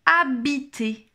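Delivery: slow, firmly projected test word; drawn-out, careful dictation. Shown as a demonstration. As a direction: separate the syllables clearly for the pupil. Teacher calls out test word.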